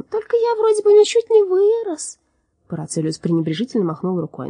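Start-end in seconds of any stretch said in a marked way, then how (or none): 0.0-2.2 high metallic giant voice; high robot voice. Acted. Drom's voice continues.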